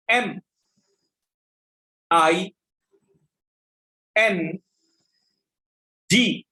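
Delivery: slow classroom dictation, one letter at a time. Acted. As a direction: call out each letter clearly for the class to copy.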